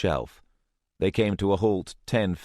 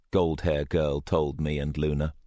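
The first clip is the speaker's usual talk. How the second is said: ordinary delivery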